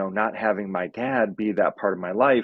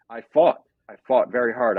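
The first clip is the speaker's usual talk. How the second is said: choking up; 3 semitones higher, 4 dB louder than usual. Real, not acted. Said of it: "I'm gonna cry about this".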